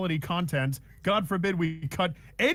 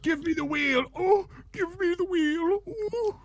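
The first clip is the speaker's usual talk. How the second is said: high, strained cry for the wheel, rising to a squeal; whiny squeal; 13 semitones higher, slower than usual. Retold or imitated. Acting out a friend fighting over the car wheel, high whiny cry. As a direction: wail like a desperate passenger grabbing the wheel.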